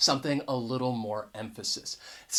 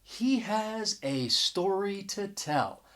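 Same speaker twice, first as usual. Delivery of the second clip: slow, softer, "a" as AY before "story"; emphasis on the noun; 3 semitones higher, 5 dB quieter, slower than usual. Shown as a demonstration. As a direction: draw out "a story" slowly, saying "a" as "ay". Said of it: "giving that noun a bit more emphasis".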